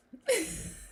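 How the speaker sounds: high bright laugh; bursting laugh. Real, not acted. Laughing at bus idea.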